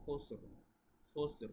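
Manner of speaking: খুসর with both final consonants stopped hard; demonstrating খুসর for students; careful pronunciation. Shown as a demonstration. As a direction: say খুসর clearly with two jazm stops. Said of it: "কিন্তু এভাবে".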